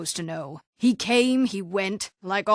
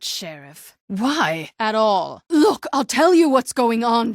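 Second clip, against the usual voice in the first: usual speaking voice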